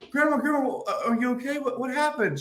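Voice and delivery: high, anxious mock concern; worried calling. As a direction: rush in asking if she's hurt. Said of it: "I was like".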